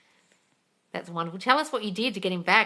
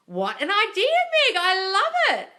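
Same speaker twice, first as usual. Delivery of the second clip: higher and louder, bubbling over; gushing praise; 13 semitones higher, 7 dB louder, slower than usual. Real, not acted. Host praising a viewer's idea, gushing. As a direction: rave about the idea like it's genius.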